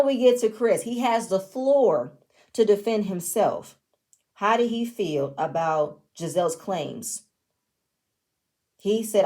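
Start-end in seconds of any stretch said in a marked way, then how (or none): none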